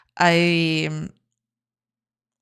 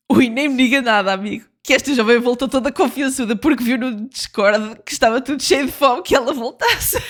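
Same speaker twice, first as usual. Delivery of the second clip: teasing voice; 4 semitones higher than usual. Pretend. Teasing Portuguese aside.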